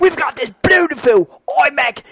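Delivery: put-on high, hammy Australian accent; cheerful Aussie bloke, Australian accent. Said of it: "the Australian guy".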